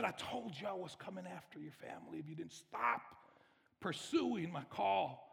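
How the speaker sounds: softened threat, slowing on the last words; menacing enemy voice. Acted. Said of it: "And that voice said".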